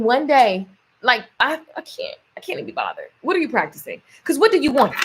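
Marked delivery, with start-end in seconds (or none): 1.8-3.1 exasperated whisper. Real, not acted. Exasperated aside.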